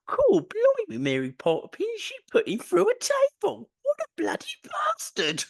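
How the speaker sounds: very high hammed-up Cockney squeal; mock London accent, shrieked high. Joking about wrestling in a put-on London accent. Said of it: "speaking in London accents".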